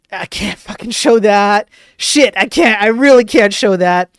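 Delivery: loud, frustrated complaint; exasperated outburst. Real, not acted. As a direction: blurt it out in real annoyance. Frustrated at not showing picture.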